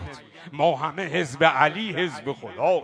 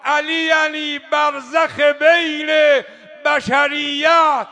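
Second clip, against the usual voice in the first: slow, loud chanted praise, much higher; preaching at full force; 8 semitones higher, 8 dB louder, much slower than usual. Acted. Preacher chanting Ali's titles to the crowd, slow and loud. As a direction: proclaim each title of Ali like a rally cry.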